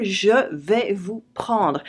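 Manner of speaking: "je vais vous prendre" said slowly and clearly; slow teacher modelling of a French phrase; slow model phrase. Shown as a demonstration. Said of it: "Note here the structure".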